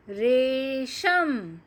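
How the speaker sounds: रेशम drawn out, re-sham, each syllable clear; slow model reading. Shown as a demonstration. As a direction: model रेशम slowly for the class. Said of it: "धीरे धीरे सही उच्चारण".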